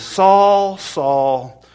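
SAUL called loud and drawn out, twice; emphatic name-calling. Retold or imitated. Jesus confronts Saul by name, emphatic rebuke. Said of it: "You see, it's emphatic".

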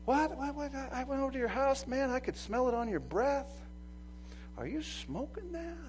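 lightly voiced busybody calling you out; a hint of a prying church member; nosy church member. Church people catching a believer's sins, mock-shocked. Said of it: "people are gonna go".

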